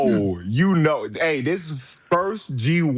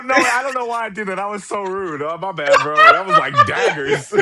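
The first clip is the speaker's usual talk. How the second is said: laughing apology; 9 semitones higher, 5 dB louder than usual. Real, not acted.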